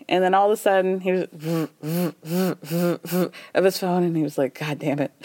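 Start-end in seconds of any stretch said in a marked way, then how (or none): 1.3-3.3 buzzing phone noise in short bursts; imitated phone vibration. 4.6-5.1 low, soft grumbled curse; resigned mock grumble. Retold grumble at buzzing phone.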